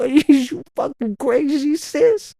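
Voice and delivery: laughing disbelief, high; incredulous outrage. Retold or imitated. Telling his sister he won't play with a doll, high and incredulous. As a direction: pitch shoots up on sis.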